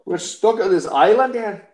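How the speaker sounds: botched Scottish that comes out Irish; Irish accent. Acted. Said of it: "it's right to my Irish accent again".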